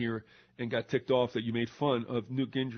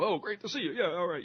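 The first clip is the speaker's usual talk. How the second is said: higher, mock glad-handing greeting; fake politician cheer; 5 semitones higher than usual. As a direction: gush at the voter like a candidate. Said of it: "you know".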